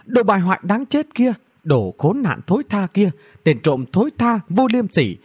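high-pitched string of insults; indignant acted tirade; cursing. A narrator's reading. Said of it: "Cô ta không ngừng chửi rủa".

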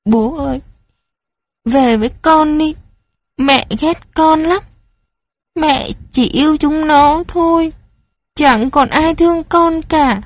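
high, pleading cry to an absent father; child weeping for father, hoarse and lonely. Acted. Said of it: "Tôi khóc".

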